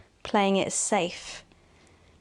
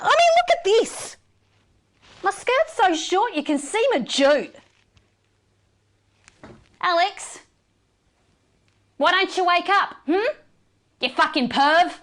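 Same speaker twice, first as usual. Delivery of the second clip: much higher, louder outraged complaint; indignant outburst; 8 semitones higher, 3 dB louder than usual. Acted. The news anchor rages at Alex over her outfit, high and loud. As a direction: let the outrage spike up high.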